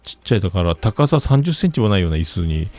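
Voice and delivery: ordinary talk, nothing marked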